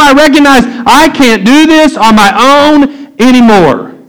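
impassioned preaching. Real, not acted. Preacher urging congregation to admit need, in impassioned preaching.

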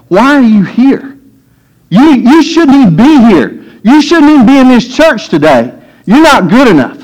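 high, sneering discouraging voice; mocking inner critic; voice of limitation. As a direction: belittle them from above. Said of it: "the voice of limitation speaks to us".

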